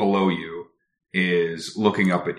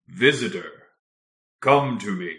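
booming voice. Acted. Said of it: "a booming voice from down below".

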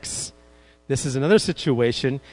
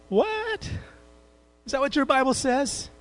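mock surprise; 8 semitones higher than usual.

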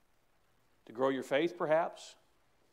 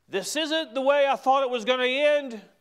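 high, loud voice of a worried Paul; Paul's anxious thought; 10 semitones higher, 8 dB louder than usual. Retold or imitated. Preacher voicing Paul's fearful thought in the storm, high and loud. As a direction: fret that it is all ending wrong.

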